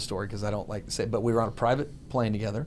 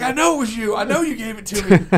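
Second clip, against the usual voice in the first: angry; 11 semitones higher, 6 dB louder than usual. Retold or imitated. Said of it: "He's like".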